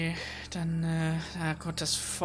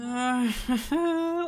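a high burst of laughter; loud laugh; 7 semitones higher, 4 dB louder than usual. Real, not acted. Laughs at own mistake. (lautes Lachen)

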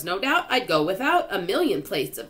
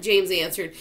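ordinary delivery

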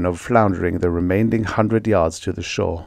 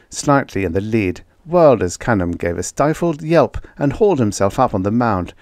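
usual speaking voice

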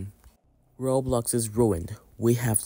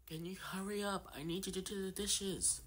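higher, much softer, distant mom voice; mom calling from afar; 8 semitones higher, 16 dB quieter than usual. Acted. Mom nagging her kid about dishes, far off and higher. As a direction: call out to your kid from another room.

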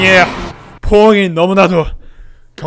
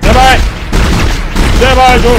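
louder frustrated begging; loud pleading; 4 semitones higher, 4 dB louder than usual. Real, not acted. Gamer missing shots loudly begs to hit. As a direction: beg loudly for the shot to land.

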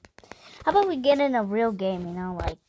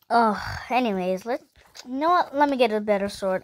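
normal talking voice

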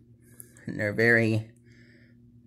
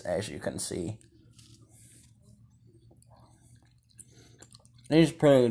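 talking between bites; chewing while talking. Real, not acted. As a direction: mouth full of gummy. Seen 4: Bites purple gummy at -4, then another piece into mouth at 11.7.

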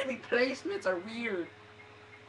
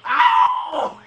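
loud, harsh ow; sudden loud yelp; pained yell; 12 dB louder than usual. Real, not acted. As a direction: holler as the blow lands. Hit again in game.

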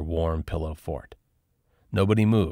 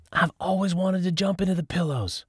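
ordinary delivery

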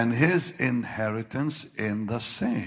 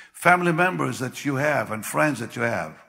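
ordinary talk, nothing marked

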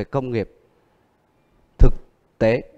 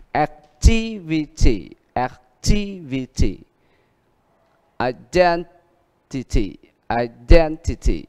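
activity and identity slowly, weight before ity; slow teacher model, ity ending; stress before ity; slower than usual. Shown as a demonstration. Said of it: "Đuôi nữa là ity".

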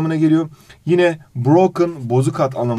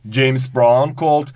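ordinary talk, nothing marked

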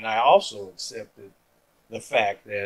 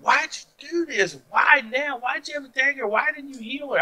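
higher, mild mock protest; imitating anger at God; 14 semitones higher than usual. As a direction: lightly act out railing at the Lord. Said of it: "I could have got angry at the Lord".

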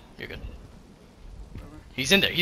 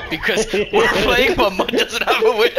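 laughing; 7 semitones higher, 5 dB louder than usual. Real, not acted. Laughing while teasing.

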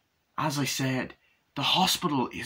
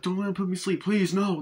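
higher, mock-panicked pleading; freaking out; 9 semitones higher, 3 dB louder than usual. Laurie begs not to be put to sleep, freaking out. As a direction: plead in a raised, worked-up voice. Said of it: "she's all freaking out".

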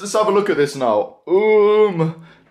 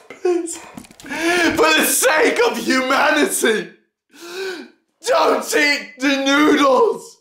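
pleading shout; 6 semitones higher than usual. Real, not acted.

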